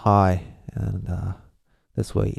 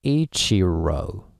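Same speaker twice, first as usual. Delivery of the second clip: Ichiro slow and drawn out, rising at the end; slow, weighted Ichiro; English-style pronunciation; much slower than usual. Shown as a demonstration. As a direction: stretch Ichiro into EE-chee-ro with English stress. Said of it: "you would go like".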